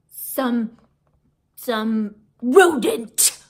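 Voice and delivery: rodent stretched slow and loud; disgusted rodent. A narrator's reading. Missus Brisbane sneering at the hamster, slow loud rodent. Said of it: "Nobody says rodent quite the way".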